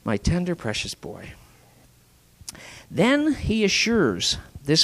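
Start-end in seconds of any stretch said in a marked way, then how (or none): 0.0-1.3 a father's soft, loving whisper; tender whisper. Acted. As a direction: hush it like comforting a child. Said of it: "this dad responds by saying".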